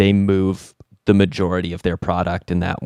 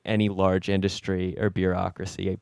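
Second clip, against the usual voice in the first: normal talking voice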